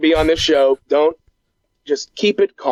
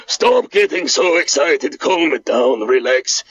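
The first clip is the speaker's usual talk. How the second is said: put-on lower, scolding Eastern European accent; Boris voice; 3 semitones lower than usual. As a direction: tell your cousin to calm down in the accent. Boris calms Bart.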